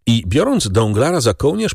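ordinary delivery

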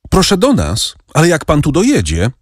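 high voice brimming with joy; high, glad calling voice; joyful call. A narrator's reading. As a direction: call out happily, pitched well up. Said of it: "zawołała Kasia nabrzmiałym radością głosem".

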